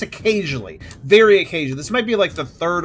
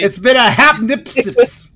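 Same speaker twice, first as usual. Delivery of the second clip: reading through giggles, louder; laughing; 6 semitones higher, 9 dB louder, slower than usual. Real, not acted. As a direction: lose it while reading aloud. Stumbles while reading, laughing.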